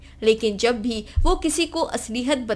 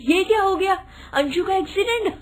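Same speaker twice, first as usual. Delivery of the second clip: higher, giggly exclaim; mock-shocked; 7 semitones higher, faster than usual. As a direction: act shocked, half laughing. Daughter-in-law, high, laughing. (हंसी में हैरानी)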